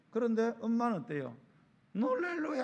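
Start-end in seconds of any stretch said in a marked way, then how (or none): 1.9-2.6 high-pitched mock wail of an exasperated mom; mother at wit's end. Preacher mimics a mom worn out by her noisy child, exasperated high cry. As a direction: imitate a mother driven to distraction, pitched high. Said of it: "막 죽을 지경이에요".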